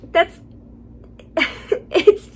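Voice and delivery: breaking into laughter while starting to speak; too tickled to finish the sentence; incredulous laughter. Real, not acted. Too incredulous to get the words out.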